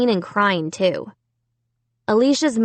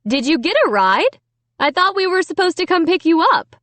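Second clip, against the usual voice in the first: calling from the kitchen; 7 semitones higher than usual. A narrator's reading. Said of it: "Mom called from the kitchen".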